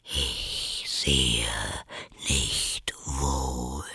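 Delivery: low, soft whisper; caterpillar. A narrator's reading. The caterpillar coolly contradicts Alice in a whisper. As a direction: dismiss her in a low whisper.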